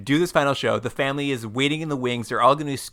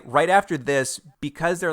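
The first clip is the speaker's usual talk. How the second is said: usual speaking voice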